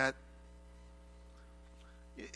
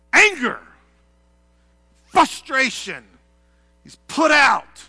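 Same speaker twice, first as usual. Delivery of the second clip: angry outburst; 8 semitones higher, 14 dB louder, slower than usual. Acted. Preacher voicing Jesus' anger.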